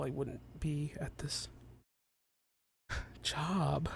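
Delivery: hushed defeated whisper. Acted. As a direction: breathe it out under the breath. Professor sighs defeated.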